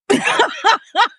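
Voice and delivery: a burst of high, loud real laughter. Real, not acted. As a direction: laugh out loud in disbelief at what she did. Laughing at her own boldness.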